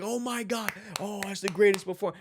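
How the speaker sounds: high-pitched gushing crowd reaction; hammed-up astonished praise; amazed onlooker. Acted amazed crowd on set.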